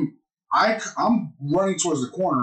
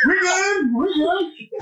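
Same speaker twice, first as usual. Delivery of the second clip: high, strained 'all fine'; mock reassurance; 13 semitones higher, much slower than usual. Concussed player at the door to mom, high mock reassurance. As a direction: squeak out a hammed-up reassurance. Dazed player insisting he is fine.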